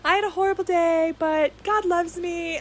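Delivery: high, sweet imitation of a gentle friend; Mary impression. Host imitates how her guest talks all the time, high gentle mimicry. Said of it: "this is how she talks all the time".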